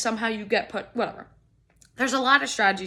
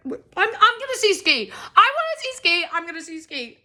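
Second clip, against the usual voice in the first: much higher and louder, fired up; excited insistence; 8 semitones higher, 6 dB louder than usual. Real, not acted. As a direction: push through with eager determination. Determined to reach the front.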